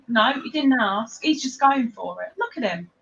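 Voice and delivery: ordinary talk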